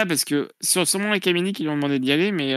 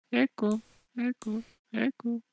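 fading repeated echo, higher and softer; canyon echo; 8 semitones higher, 7 dB quieter than usual. Pretend. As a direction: call the name out like a fading echo. Host echoes the name Echo.

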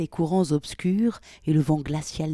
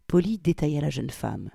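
plain reading voice

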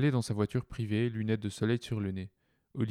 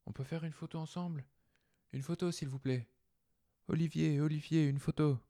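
eager fans; 6 semitones higher than usual. Acted. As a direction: plead for a selfie. Crowd fans begging for photos.

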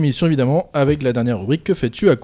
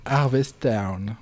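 English accent; 3 semitones lower, 5 dB quieter, much slower than usual. Shown as a demonstration. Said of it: "j'adore le faire répéter".

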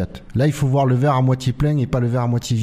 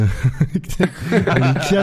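laughing; much slower than usual. Real, not acted. Joke about a drinking driver duo.

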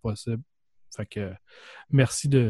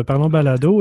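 ordinary talk

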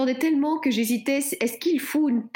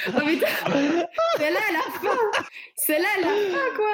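laughing coo; 8 semitones higher, much faster than usual. Real, not acted. Cooing at otter video.

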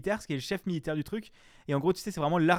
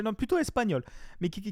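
ordinary delivery

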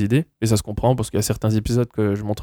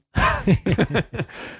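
high laugh; 10 semitones higher than usual. Real, not acted. Laughs at own accent.

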